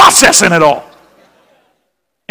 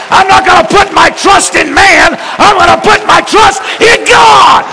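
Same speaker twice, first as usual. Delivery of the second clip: preaching at full force; 6 semitones higher than usual. Acted. Preacher urging the congregation to trust God, rising shout. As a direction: build it up like a revival climax.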